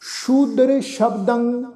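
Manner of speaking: slow, drawn-out recitation; recitation demo. Shown as a demonstration. Teacher recites the verse phrase for learners, slow recitation. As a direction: stretch both words evenly. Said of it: "ਉਚਾਰਨ ਕਰਾਂਗੇ".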